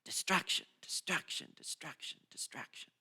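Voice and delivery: repeated word fading from soft to a whisper. Acted. Preacher letting the word fade out into a whisper. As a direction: let each repeat drift away into a whisper.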